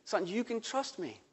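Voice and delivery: soft, gentle fatherly reassurance; calm a scared son softly; reassuring father. Retold or imitated. Dad on the raft gently reassuring his frightened son, soft and tender. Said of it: "my dad says".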